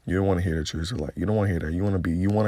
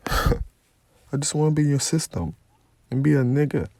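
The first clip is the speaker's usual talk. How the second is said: mocking protester plea; 6 semitones higher than usual. Preacher mimics protesters begging their oppressor, high whiny mockery. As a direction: whine it like a protester begging to be equal. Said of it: "you're protesting, talking about".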